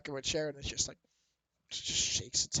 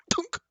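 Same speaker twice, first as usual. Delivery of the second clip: cork pop; 8 dB louder than usual. Acted. Cork pops free.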